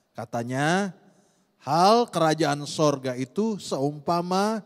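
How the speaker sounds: loud, measured Bible reading; scripture reading. Acted. Pastor reading Matthew to the church, loud solemn reading. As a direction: read the verse out solemnly to the hall.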